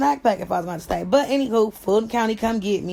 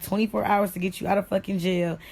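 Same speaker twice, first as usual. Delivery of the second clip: ordinary talk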